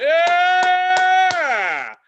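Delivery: long, high, loud whoop; mock cheer. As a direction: draw out a sarcastic roar of applause. Acted cheer with mocking slow clap.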